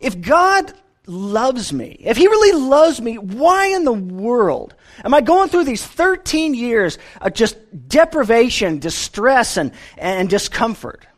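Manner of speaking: a lightly voiced cry of why me, slowly; anguished inner plea. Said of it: "Joseph thought to himself".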